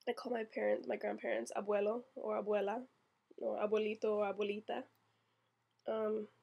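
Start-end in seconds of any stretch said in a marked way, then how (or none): 1.6-4.8 abuelo, abuelita said with Spanish vowels and a tapped r; Spanish pronunciation. Real, not acted. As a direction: switch to natural Puerto Rican Spanish for abuelo and abuelita. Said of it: "Puerto Rican".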